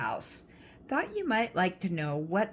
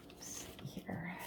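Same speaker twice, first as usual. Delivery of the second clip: whispering; 15 dB quieter than usual. Real, not acted. Muttered to self while searching.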